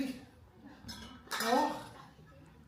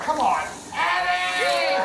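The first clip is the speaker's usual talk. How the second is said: shout; 10 semitones higher, 9 dB louder, slower than usual. Acted. Host calling out Eddie.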